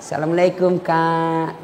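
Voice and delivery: a hint of a girl cooing softly to her boyfriend; sweet polite greeting. Girl greeting boyfriend on the phone, lightly sweet and soft. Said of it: "sopan kalau sama cowoknya".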